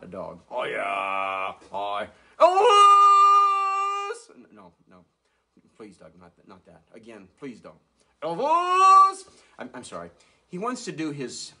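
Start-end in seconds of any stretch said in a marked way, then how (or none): 2.4-4.4 high loud drawn-out yell. Acted. Dog puppet interrupts ventriloquist with a high loud shout. 4.4-7.7 much softer, lower begging; hushed pleading. Acted. Ventriloquist quietly begs the dog not to do it. 8.2-9.2 high loud drawn-out yell. Acted. Dog puppet interrupts ventriloquist with a high loud shout.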